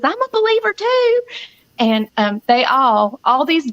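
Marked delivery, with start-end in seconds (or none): 0.0-1.2 much higher, small sweet nurse's voice; little nurse's voice. Retold or imitated. A little nurse chimes in from the background, high and sweet. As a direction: pipe up like a timid little nurse. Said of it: "this little nurse in the background says".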